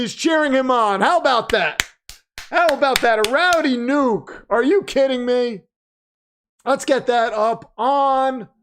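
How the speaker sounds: hype announcer. Acted. Announces new champion to stream.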